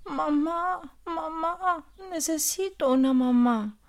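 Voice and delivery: a lightly acted sob of longing; crying. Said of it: "empezó a llorar".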